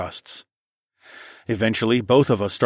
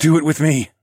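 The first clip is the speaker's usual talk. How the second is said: raised, forceful command; ordering; 7 semitones higher, 7 dB louder than usual. A narrator's reading. Narrator commanding Kat, ordering. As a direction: give a loud, urgent order. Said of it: "I ordered".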